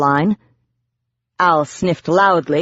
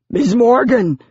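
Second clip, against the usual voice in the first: higher-pitched shout in Biz's voice; 6 semitones higher, 4 dB louder than usual. A narrator's reading. Biz to Morgan as she is hit by vertigo, shouting. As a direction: call out in alarm as the gargoyle. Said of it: "Biz shouted".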